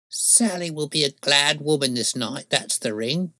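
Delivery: put-on high old-woman voice, thankful; delighted crone in a high voice; relieved old crone. A narrator's reading. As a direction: play a glad old woman, pitched well up. Old crone rejoices at ring.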